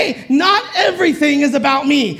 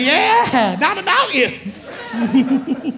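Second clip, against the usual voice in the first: sister's laughing quip; much slower than usual. Retold or imitated. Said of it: "She said".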